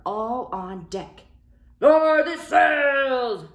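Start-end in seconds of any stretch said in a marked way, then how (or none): 1.8-3.5 higher, loud, slow storm command; pirate shout. Acted. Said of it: "Braidbeard shouted".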